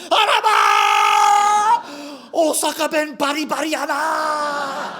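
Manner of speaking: high, slow, shrieking Osaka shop lady; shop lady's shriek. Retold or imitated. Convenience store clerk shrieking praise at his Osaka dialect. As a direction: burst out in a piercing high cry of delight. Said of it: "And she said".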